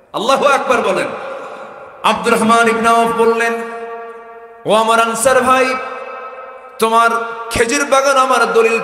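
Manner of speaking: loud, high, ringing sermon cries; full-force preaching. Real, not acted. Preacher retelling the companion's refusal, loud impassioned preaching. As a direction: call it out to rouse the crowd.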